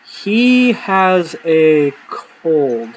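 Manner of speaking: slow, flat-faced cover story; deadpan excuse. Retold or imitated. Covering for dad with a slow, deadpan lie. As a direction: drag it out like nothing's wrong. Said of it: "Just like that".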